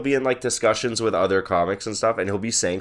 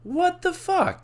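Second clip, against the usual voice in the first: shocked disbelief; much slower than usual. Real, not acted. Shocked friend doesn't know age.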